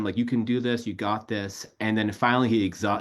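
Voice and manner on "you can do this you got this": normal talking voice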